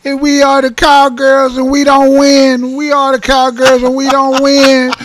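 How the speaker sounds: high, drawn-out mock wail; mock crying. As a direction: wail it like a sore loser. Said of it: "over there crying, talking about".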